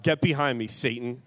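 ordinary delivery

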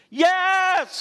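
a sudden high, loud yes; hollered yes. Retold or imitated. Mayor answering the altar call, hollering yes. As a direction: burst out with the yes. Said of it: "he hollered".

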